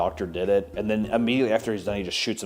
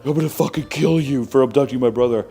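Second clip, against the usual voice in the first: higher and louder threat; mock angry threat; 4 semitones higher, 6 dB louder than usual. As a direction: yell the threat at the kidnapper. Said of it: "and he's like".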